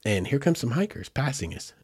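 ordinary talk

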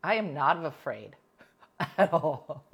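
laughing through it. Real, not acted. Host repeating her boast, laughing. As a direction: giggle the repeat. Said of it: "repeated with laugh".

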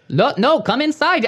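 high, insistent mock Indian accent; oblivious insistence in an imitated Indian-sounding accent; Ray. Ray brushing off the locked door, high imitated Indian-sounding accent. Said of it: "Why does he sound Indian?".

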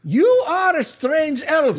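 high, slow, emphatic retort; insistent matron. Acted. Matron retorting to the elf, indignant. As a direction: hammer it out slowly, pitched high.

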